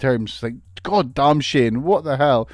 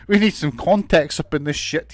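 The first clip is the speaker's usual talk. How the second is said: ordinary talk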